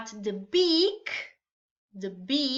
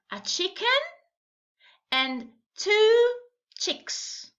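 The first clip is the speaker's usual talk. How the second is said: put-on reading to a toddler; 3 semitones higher than usual. Teacher questioning small children.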